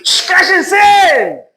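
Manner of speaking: long shush into high shout; crowd's rebuke. Acted. Crowd silencing everyone calling on Jesus, shouted rebuke. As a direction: hush them, then stretch the command out high. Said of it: "la gente decía".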